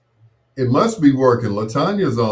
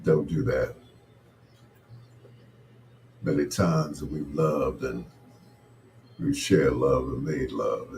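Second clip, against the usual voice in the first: smooth seductive talk; 8 semitones lower than usual. Acted. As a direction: purr it like a late-night soul crooner.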